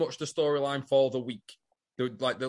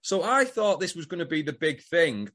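normal talking voice

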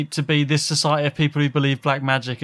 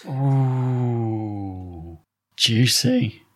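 drawn-out, lower gossip-hungry ooh; titillated; 4 semitones lower, much slower than usual. Real, not acted. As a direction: savour the juicy gossip. Gossip about who is a Mason.

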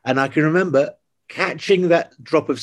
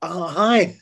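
mock sheepish hello, pitched higher; retold starstruck greeting; 6 semitones higher than usual. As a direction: imitate your own feeble little greeting. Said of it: "I just kinda, like, went".

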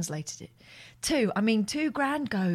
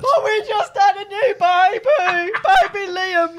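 loud hammed-up sobbing plea; loud fake crying; 5 semitones higher, 9 dB louder than usual. Fake victim begging the bank agent, loud mock crying. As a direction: bawl about the baby, overdone. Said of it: "Please don't do it so loud".

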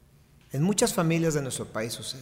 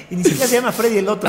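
laughing; 4 semitones higher, 7 dB louder, faster than usual. Real, not acted. Host laughs at the name mix-up.

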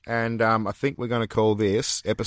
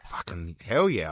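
whispered; 3 semitones lower than usual. Real, not acted. Reacting to Belgium.

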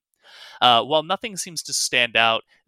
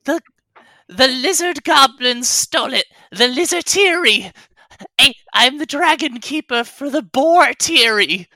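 high, loud creature voice, stumbling over words; tiny creature pleading its case; upset little kobold; 11 semitones higher, 6 dB louder than usual. Acted. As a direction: explain the disaster as a squeaky, anxious kobold. Plays the kobold.

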